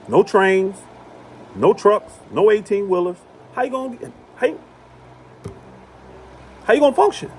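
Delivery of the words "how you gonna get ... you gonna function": higher and higher, incredulous; incredulous rising. Real, not acted. To listeners with no food, rising incredulous question. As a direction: push the question up in disbelief.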